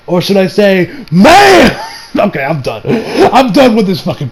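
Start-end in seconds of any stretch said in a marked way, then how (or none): 1.1-1.8 high, loud drawn-out yell; hammed-up shout. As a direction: belt out one exaggerated word. Acted shout to end joke.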